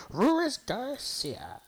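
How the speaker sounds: slow Ruiz Garcia, with the R bent toward an L; mother-in-law's Ruiz Garcia. Shown as a demonstration. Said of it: "how would my mother-in-law say it?".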